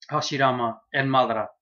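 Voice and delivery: usual speaking voice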